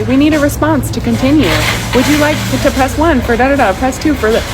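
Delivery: high, mechanical recorded-menu voice; lightly mocking automated operator; automated phone menu voice. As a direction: mimic the robotic phone line.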